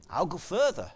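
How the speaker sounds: ordinary talk, nothing marked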